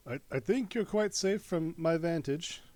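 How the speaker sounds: loud, high, smug tone; haughty commander. Acted. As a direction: lord it over them from above. In-character argument.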